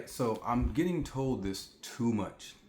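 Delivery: ordinary talk, nothing marked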